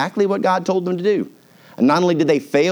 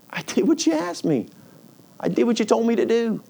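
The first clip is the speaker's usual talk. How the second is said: high, hurt pleading without volume; plaintive protest; 8 semitones higher than usual. Acted. Believer pleading with God after obeying, plaintive. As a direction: protest in a small, higher, wounded voice.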